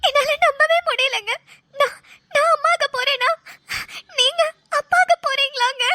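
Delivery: tearful, halting disbelief; overwhelmed wife, voice lifted by tears; happy crying. Acted. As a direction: sob with joy between short phrases. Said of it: "இந்த நேரத்துல நீ அழக்கூடாது".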